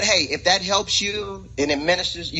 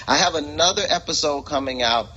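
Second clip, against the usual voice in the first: normal talking voice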